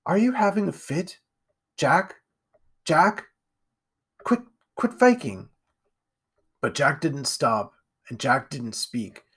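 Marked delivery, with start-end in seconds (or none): 0.0-5.5 put-on high, uncertain child's voice; nervous young boy; alarmed little boy. A narrator's reading. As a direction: check on him with rising worry. Boy alarmed by Jack's fit.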